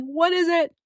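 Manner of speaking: high-pitched mock panic; retold freaking out. Traveller hopping tables hunting a working outlet, high mock panic. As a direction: squeal it in exaggerated desperation. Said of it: "So I start freaking out".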